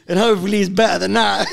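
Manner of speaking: loud, high-pitched, cracking up; laughing exasperation. Real, not acted. Hurrying mates to the next question, loud laughing voice. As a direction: urge them on through helpless laughter.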